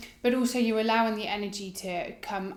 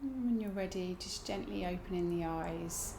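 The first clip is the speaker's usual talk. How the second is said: guided meditation; 2 semitones lower, 12 dB quieter than usual. Acted. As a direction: ease them into stillness. Guides breathing practice.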